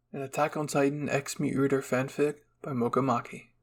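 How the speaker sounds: ordinary talk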